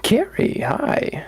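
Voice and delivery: high squeaky greeting. Acted. Rachel greets emaciated Gary.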